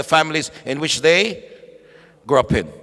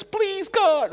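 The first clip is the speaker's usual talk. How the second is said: high, soft begging cry; a broken whispered-up plea; pleading; 3 dB quieter than usual. Acted. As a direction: plead quietly upward to God. Abraham begs God again.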